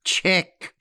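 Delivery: high, croaked chess call; old woman's triumphant croak. A narrator's reading. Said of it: "occasionally croaked".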